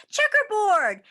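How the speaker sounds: loud, high shout of checkerboard; kid shout. Acted. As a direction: call it out to taunt. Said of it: "Someone else shouted".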